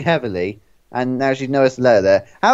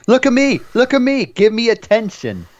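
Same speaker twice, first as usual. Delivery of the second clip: attention-seeking shouting; 9 semitones higher than usual. Retold or imitated. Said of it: "this is exactly what I say".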